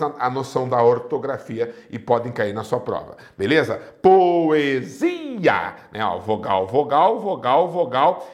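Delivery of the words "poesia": Poesia drawn out as po-e-si-a; Poesia, stretched and split for a class; syllable by syllable. Shown as a demonstration. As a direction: give each vowel of Poesia its own long syllable. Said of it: "cada vogal ficou numa sílaba diferente".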